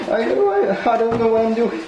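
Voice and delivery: laughing through the words, a bit fast. Real, not acted. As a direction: giggle while admitting you're lost. Unboxing, laughing at own fumbling.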